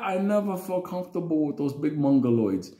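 usual speaking voice

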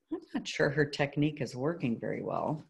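plain reading voice

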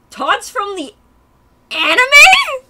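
high, very loud disbelieving cry; screeched astonishment, rising to a peak; shocked shriek. Real, not acted. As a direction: shriek the last word in sudden realisation. Sudden realisation about Todd.

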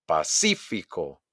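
slow "Pacífico", punched on CÍ; exaggerated second-syllable stress. Shown as a demonstration. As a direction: hit CÍ in a stretched "Pacífico". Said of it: "exagerar la pronunciación". ("Pacífico" lento, con golpe en CÍ)

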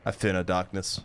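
very low-pitched heroic line; low declaration. Acted. Gamer declaring bravery to himself, low-pitched declaration. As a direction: say it deep down in your range. Hero line said two ways.

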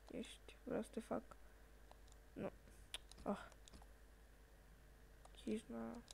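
whispered under his breath. Real, not acted. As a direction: mutter it unvoiced to yourself. Whispered aside at the screen. (șoptit, ca pentru sine)

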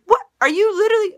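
sudden high, loud disbelief; a sharp, exasperated jump up in pitch; real shock breaking out; incredulous. Real, not acted. Incredulous outburst mid-rant.

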